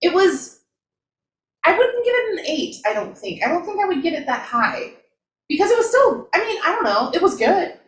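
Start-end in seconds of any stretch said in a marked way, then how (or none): none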